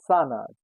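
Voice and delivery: Zahnarzt said "TSAHN-arts"; Zahnarzt, Z sounded like s; German pronunciation. Shown as a demonstration. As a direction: hiss both z sounds in Zahnarzt. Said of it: "sounds as S".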